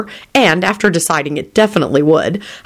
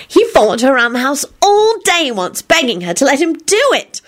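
high, gleeful calling out; hollering; 9 semitones higher than usual. A narrator's reading. Solange defying Quentin's hushing, high holler. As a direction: holler it over the objection. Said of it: "Solange hollered in response".

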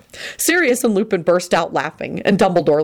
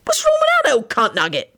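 angry; 11 semitones higher than usual. A narrator's reading. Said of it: "Sirius said angrily".

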